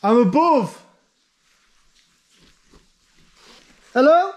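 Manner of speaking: loud call down a shaft; calling down from tower. Real, not acted. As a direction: shout down to someone far below. Calling down to friend below.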